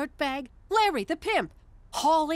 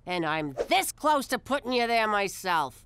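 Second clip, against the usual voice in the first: angry threat; 9 semitones lower than usual. Acted. Wife threatening husband.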